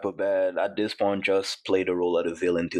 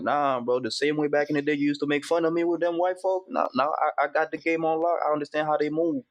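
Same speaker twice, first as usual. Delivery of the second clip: higher-pitched, lightly acted pushback; defiant retort; 6 semitones higher than usual. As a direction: push back at old friends in a raised pitch. Replying to friends who questioned him.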